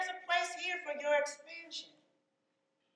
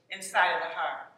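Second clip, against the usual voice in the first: guided exercise; 6 semitones lower than usual. Acted. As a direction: lead them gently through it.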